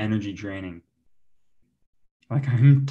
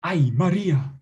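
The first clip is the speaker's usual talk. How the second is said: higher, melodramatic Spanish lover; Spanish accent; 4 semitones higher than usual. Shown as a demonstration. Comic as a lover marvelling to Maria, impassioned Spanish accent. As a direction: gush in a hammed-up Spanish accent.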